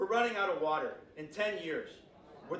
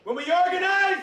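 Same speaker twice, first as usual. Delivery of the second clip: shouted chant call, much higher; rally speech; 12 semitones higher, 12 dB louder than usual. Real, not acted. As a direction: lead the crowd chant, shouting. Call and response with crowd.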